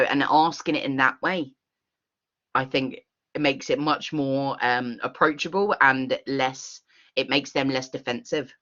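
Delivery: ordinary talk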